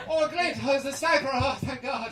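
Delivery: high, loud hammed-up delight; overjoyed relief. Local spotting a sniper's glint, mock overjoyed relief. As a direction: cry out as if rescued at last.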